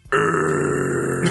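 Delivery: low, growled urgh; wrestler grunt. Acted. Imitated grunt.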